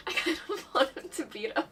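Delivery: giggling through the words; laughing. Real, not acted. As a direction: say it while giggling. Laughing confession.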